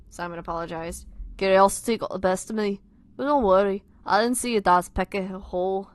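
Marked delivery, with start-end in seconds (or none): 1.4-5.9 loud, amateur Scouse; Liverpool accent. Acted. Tom asking Simon to look, Liverpool accent. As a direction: lay on a put-on Liverpool accent. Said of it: "time for my Liverpool accent".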